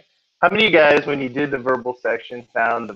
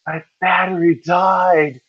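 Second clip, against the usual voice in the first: higher, slow whiny student voice; mock student whine; 6 semitones higher, 3 dB louder, slower than usual. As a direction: play the panicked student, stretched out. Student reports dead calculator.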